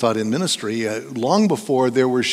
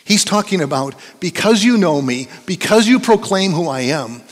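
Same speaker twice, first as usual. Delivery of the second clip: loud, raised preaching voice; emphatic pulpit delivery, hammering key phrases; forceful preaching; 5 semitones higher, 5 dB louder than usual. Real, not acted. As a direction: drive the warning home to the congregation.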